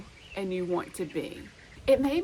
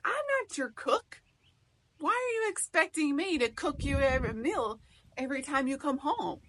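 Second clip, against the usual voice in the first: much higher, mock-indignant husband voice; defensive husband; 7 semitones higher than usual. Husband shooting back at his wife, high and indignant. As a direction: play the husband snapping back, pitched way up. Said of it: "He's gonna be defensive".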